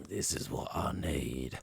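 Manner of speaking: slow low menacing whisper; threatening whisper. Retold or imitated. Driver whispering a threat at his rival, slow and unvoiced. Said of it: "when he gives him the threat".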